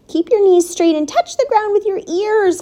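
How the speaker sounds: high-pitched coaching call. A narrator's reading. Charlotte coaching the pig's exercises, calling out. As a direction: call instructions from a distance. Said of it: "called Charlotte".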